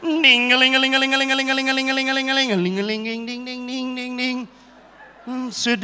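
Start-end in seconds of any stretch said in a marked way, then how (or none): 0.0-4.5 sung ling-a-ling bell jingle; imitated church bell. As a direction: ring it out like a little bell.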